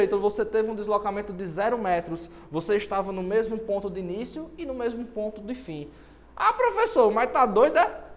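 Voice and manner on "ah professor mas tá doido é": skeptical student voice. Acted. Student mocks teacher's zero claim.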